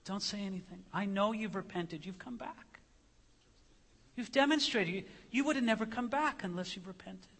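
very soft, soothing reassurance; tender hushing father. Acted. Father hushing his repentant son, tender and soft. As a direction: hush them gently, then reassure quietly. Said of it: "as if the father says".

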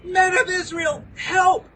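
high, loud cry for help; shouting for help. Acted. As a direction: call the crowd to seize him. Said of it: "they start shouting out".

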